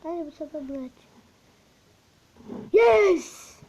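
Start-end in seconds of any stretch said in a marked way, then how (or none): 2.7-3.6 excited yell. Real, not acted. Scoring a goal in game.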